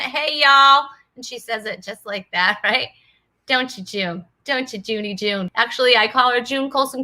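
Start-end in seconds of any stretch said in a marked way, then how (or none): 0.0-0.9 cute Southern drawl greeting; playful mock Southern hello; Southern accent. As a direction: imitate a sweet little Southern accent. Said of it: "with her cute little Southern accent".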